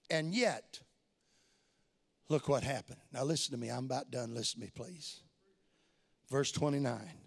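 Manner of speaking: low, soft, intimate sermon voice; hushed preaching. Acted. Hushed slow preaching passage.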